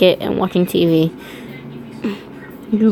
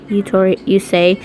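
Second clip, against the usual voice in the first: ordinary delivery, nothing marked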